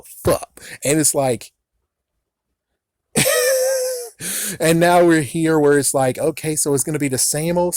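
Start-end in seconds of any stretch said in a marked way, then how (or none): 3.1-4.5 burst of laughter. Real, not acted. Reacting to theme song, laughing.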